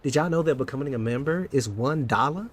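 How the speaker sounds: ordinary delivery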